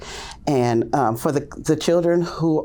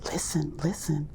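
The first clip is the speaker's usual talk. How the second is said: hushed whisper, softer; 3 semitones higher, 8 dB quieter than usual. Retold or imitated. Teacher whispering to toddlers to listen for the baby. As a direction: lean in and whisper to the kids. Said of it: "I say".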